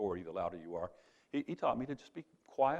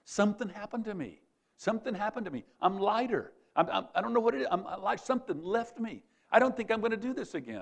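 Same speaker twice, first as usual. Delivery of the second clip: much higher and louder, bursting with relief; elated relief; 11 semitones higher, 7 dB louder than usual. Retold or imitated. Freed student running up to his teacher, high and overjoyed. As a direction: pour it out like a weight just lifted.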